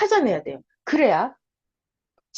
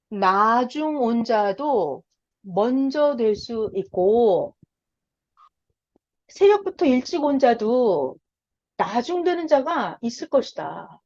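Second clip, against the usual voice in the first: very slow, weighty proclamation; slow solemn conclusion; slower than usual. Shown as a demonstration. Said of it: "결론".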